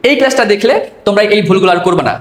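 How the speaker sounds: ordinary talk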